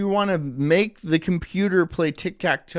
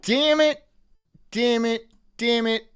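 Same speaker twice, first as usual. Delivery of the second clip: exasperated disappointment; 8 semitones higher, slower than usual. Real, not acted. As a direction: cry out in comic frustration, pitched way up. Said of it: "I'm disappointed that my sneeze went away".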